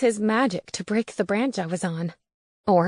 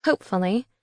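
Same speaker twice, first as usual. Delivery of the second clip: plain reading voice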